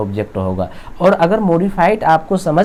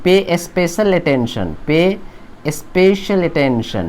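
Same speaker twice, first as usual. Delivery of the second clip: slow emphatic phrase; much slower than usual. Shown as a demonstration. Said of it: "मैं अक्सर इस्तेमाल करता हूँ".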